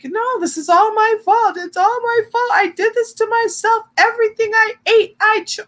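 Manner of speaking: high, frantic whine of self-blame; whiny self-blaming self. Retold or imitated. To her therapist, mock self-blaming whine pitched high. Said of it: "You know what I sound like".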